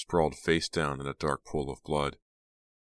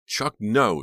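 high, loud scream; 7 semitones higher, 9 dB louder than usual. A narrator's reading. Dina screaming at her brother, loud scream. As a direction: scream to stop him. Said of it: "Dina screamed".

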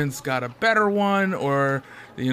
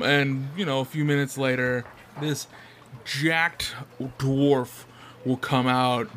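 ordinary delivery

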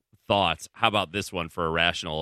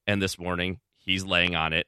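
ordinary delivery

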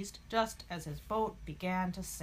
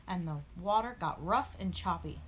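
usual speaking voice

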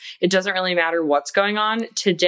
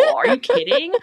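incredulous squeal. Real, not acted. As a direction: squeal like it's obvious. Emphatic incredulous outburst.

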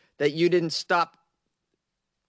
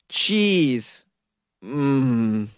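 ordinary talk, nothing marked

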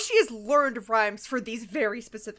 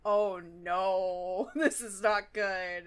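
mock dismay; 5 semitones lower, 4 dB quieter, much slower than usual. Pinkie and Rarity react with dismay.

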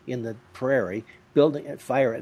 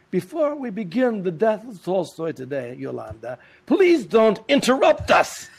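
pompous Tony; 6 semitones higher, 4 dB louder than usual. Retold or imitated. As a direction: lay down the law like a grand actor. Tony lectures wife, pompous voice.

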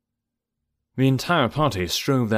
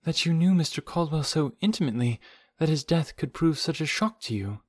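restrained, tentative delivery falling away softly; constrained voice; 6 semitones higher, 3 dB quieter than usual. A narrator's reading. Hazel to Jane about her shock, gently constrained. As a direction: tread carefully, holding feeling back. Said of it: "said Hazel in a constrained voice".

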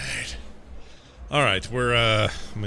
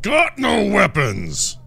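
loud, slow, defiant yell; angry shout; 12 semitones higher, 8 dB louder, slower than usual. Acted. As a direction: bellow it at the guard. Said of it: "just look at him and go".

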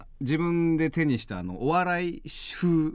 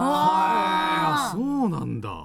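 exaggerated surprise; 8 semitones higher, 3 dB louder, much slower than usual. Mock surprised reaction.

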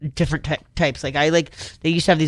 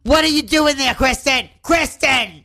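imitating mom; 9 semitones higher, slower than usual. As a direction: scold like an exasperated mother. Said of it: "when you imitate your mom".

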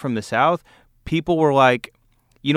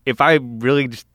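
normal talking voice

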